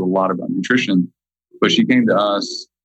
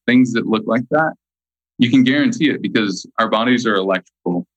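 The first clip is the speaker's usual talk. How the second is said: normal talking voice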